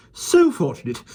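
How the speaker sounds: panted. A narrator's reading. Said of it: "Miss Lark panted".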